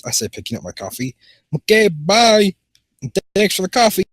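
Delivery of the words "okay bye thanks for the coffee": put-on high, cheery send-off; character's goodbye.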